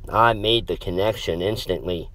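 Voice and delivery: plain reading voice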